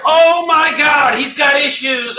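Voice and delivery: high, loud, slow panicked outcry; hammed-up wife losing it; freaking out. Wife overreacting to the vacuum scene, loud panicked outcry. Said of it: "She freaks out".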